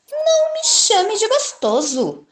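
high, proud little boaster; boastful mouse. Acted. Mouse bluffing to the Gruffalo, high and proud. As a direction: puff yourself up to sound fearsome.

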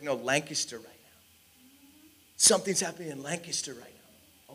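soft imagined reply from God; quiet insistence. Retold or imitated. The Lord answering the preacher's objection, quiet insistence. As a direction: answer gently in volume, holding the point.

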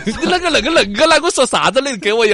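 mock the Chongqing retort with a laugh; 重庆话; Chongqing accent. Mimicking Chongqing reply.

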